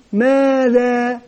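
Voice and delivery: drawn-out teacher prompt; slow drawn-out question. Shown as a demonstration. Teacher prompting pupils with a slow drawn-out question. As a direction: stretch the word out slowly to prompt pupils.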